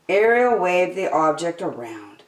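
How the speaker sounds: plain reading voice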